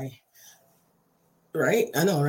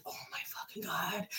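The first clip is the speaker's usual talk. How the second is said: barely-there whispered reaction; horrified whisper; 16 dB quieter than usual. Retold or imitated. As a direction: whisper it to yourself in dread, acting it out. Retold stunned thought when touched.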